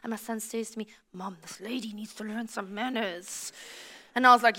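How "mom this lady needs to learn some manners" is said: low, hushed remark to mom; child's discreet aside. Retold or imitated. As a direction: imitate a kid quietly confiding in mom. Son's hushed aside about rude lady.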